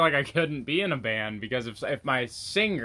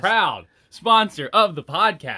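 loud, high, slow sponsor read; hammed-up ad plug, each word drawn out; mock announcer; 6 semitones higher, 6 dB louder than usual. Host plugs the podcast's sunglasses sponsor, mock announcer.

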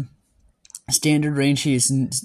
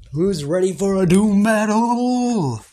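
loud, slow, drawn-out hype call; hype announcer; 6 semitones higher, 5 dB louder, slower than usual. Real, not acted. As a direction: rev up the crowd. Hyping up the battle.

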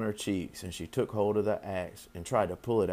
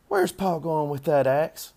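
put-on higher, louder girl's question; child character's higher voice; young Fern; 6 semitones higher, 5 dB louder than usual. A narrator's reading. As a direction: ask like a curious daughter, pitched up. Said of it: "said Fern to her mother".

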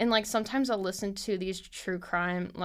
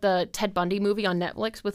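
ordinary delivery

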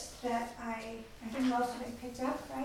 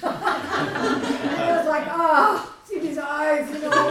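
louder, higher, laughing through a mock come-on; laughing mock flirt; 6 semitones higher, 14 dB louder, much slower than usual. Laughing as she acts out flirting with her husband, loud. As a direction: ham up a flirty look while laughing.

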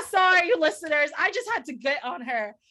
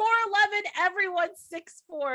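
raised-voice outburst; worked-up rant; 6 semitones higher than usual. Real, not acted. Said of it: "fucking meltdown".

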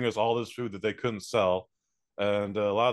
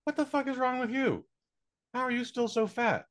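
mock outrage; 12 semitones higher than usual. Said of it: "go like".

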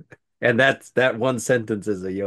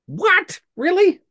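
squeaky high disbelief; genuinely stunned question; shocked; 4 dB louder, slower than usual. Real, not acted. Hearing the name source.

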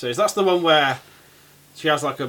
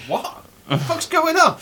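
high, slow bewildered cry; be totally lost; hammed-up high confusion; confused Doc Ock; 13 semitones higher, slower than usual. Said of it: "spends the whole film like".

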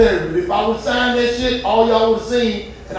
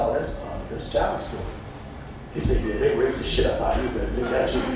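much lower, softer matter-of-fact voice; a hint of a deadpan brother; lightly voiced brother; 11 semitones lower, 9 dB quieter than usual. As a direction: deliver bad news with a shrug. Brother explains calmly.

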